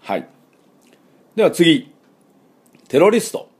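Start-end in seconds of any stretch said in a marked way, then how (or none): 2.9-3.5 テロリスト stressed on テ, higher; English terrorist. Shown as a demonstration. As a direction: hit テ in テロリスト. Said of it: "ちょっと発音が違ってきます".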